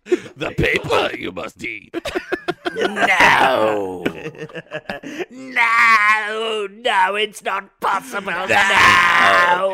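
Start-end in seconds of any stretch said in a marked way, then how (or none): 2.8-9.7 drawn-out anguished no; anguished shout. Acted. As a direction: cry out in despair. Vader no in game.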